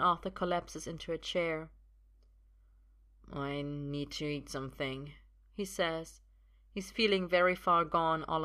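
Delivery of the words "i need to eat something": lightly voiced tipsy man, quieter; soft, woozy character line; a hint of drunken weariness; tipsy young man.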